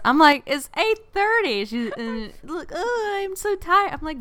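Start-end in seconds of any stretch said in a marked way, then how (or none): 0.5-1.7 high-pitched retold disbelief; exaggerated, squeaky-high incredulity; mock incredulous. Retold disbelief at early tiredness. 2.7-3.9 high-pitched imitation of tired moaning; hammed-up weary complaint; mock exhausted whine. Mimicking the co-host's tiredness.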